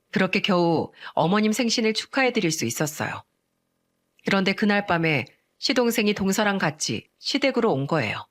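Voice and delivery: plain reading voice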